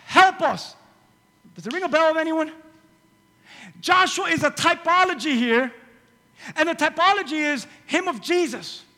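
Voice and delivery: loud, high preaching. Acted. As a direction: preach with fire. Preaching at full force.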